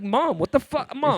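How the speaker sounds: high, loud exasperated protest; teen son's protest. Retold or imitated. Son recalls snapping back at mom, high exasperated. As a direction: push back like a fed-up teenager. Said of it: "And I'm like".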